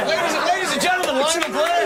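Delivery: high, fast, hyped compere; big showbiz announcer. Acted. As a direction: sell the name to the crowd. Repeats intro bigger, after teasing.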